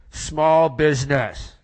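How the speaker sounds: boldly declaring small business; proud declaration. Acted. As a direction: announce it slowly to the room. Bold answer at hushed party.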